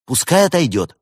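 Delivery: bright-eyed lad answering the helpline; cheerful young technician. A narrator's reading. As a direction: play an upbeat young support worker. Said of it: "молодой и жизнерадостный".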